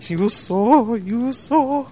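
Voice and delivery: high, playful imitation of the victim's broken English; imitating victim. Mimicking the prank call victim.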